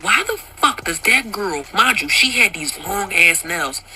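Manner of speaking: normal talking voice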